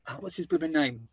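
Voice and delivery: normal talking voice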